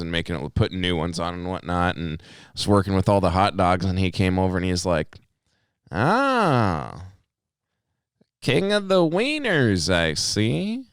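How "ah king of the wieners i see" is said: slow drawn-out mock greeting, pitched up; nonchalant teasing. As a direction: imitate a customer's unhurried, casual ribbing. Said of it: "says it very nonchalantly and very kinda low and slow".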